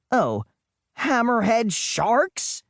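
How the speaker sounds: ordinary delivery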